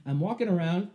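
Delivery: ordinary delivery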